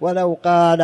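normal talking voice

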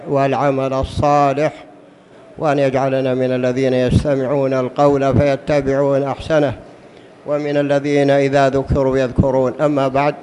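low, measured formal opening prayer; formal standard arabic. Real, not acted. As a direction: recite the opening invocation solemnly. Opening invocation before the lesson.

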